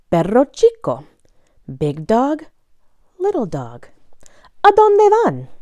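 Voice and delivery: lively sing-song picture-book reading; reading to children. Acted. Reading a bilingual dog book to young listeners, bouncy story voice. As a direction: read it like a story time for little ones.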